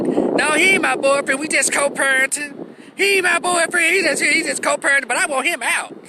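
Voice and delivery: put-on agitated girl. Girl to the cop, high and worked up. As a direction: insist hard he's not your boyfriend. Girl yelling at cops about boyfriend.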